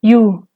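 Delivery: "Yu" on a low tone. Shown as a demonstration. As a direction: say "Yu" with a low lexical tone. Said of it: "Ahora unos ejemplos con tono bajo".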